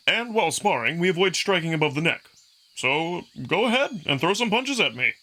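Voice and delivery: brash wolf fighter inviting punches; cocky young wolf. Acted. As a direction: egg him on with a grin. Amicus coaching Marco in sparring.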